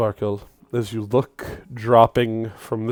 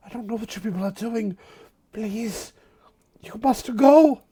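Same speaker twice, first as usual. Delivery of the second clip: higher, rushed then pleading; frightened plea; 11 semitones higher than usual. Acted. Shadow Sworn of Roses urging strangers to flee, frightened. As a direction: hurry them away, rising to a fearful cry.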